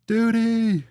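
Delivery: high, drawn-out mock cry; Caddyshack shout. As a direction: call it out high like a film quote. Quoting a film's shout.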